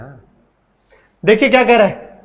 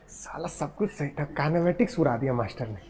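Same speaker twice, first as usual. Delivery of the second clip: mock student whisper; 5 semitones lower, 13 dB quieter than usual. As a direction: mutter it like a student cursing the teacher. Teacher voices a grumbling student. (छात्र की फुसफुसाती शिकायत)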